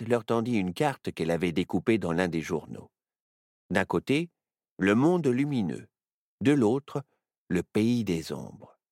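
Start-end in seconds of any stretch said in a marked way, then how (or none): none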